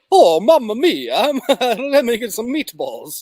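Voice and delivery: cartoon Italian accent, exaggerated and drawn out; mock Italian accent. Guessing how the Mario voice sounds, in a mock Italian accent. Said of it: "But does it sound like".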